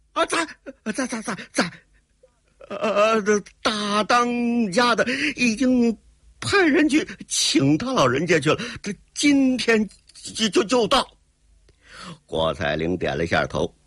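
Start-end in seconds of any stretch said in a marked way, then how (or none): 0.1-11.1 high, halting, stuttered confession; terrified stammering. Acted. Villain confesses to his interrogator, high halting frightened stammer.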